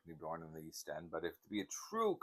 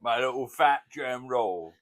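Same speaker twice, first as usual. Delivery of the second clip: slow, much louder Cockney voice; Cockney impression; 5 semitones higher, 11 dB louder, slower than usual. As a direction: drawl it like old George. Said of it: "That's what he called me".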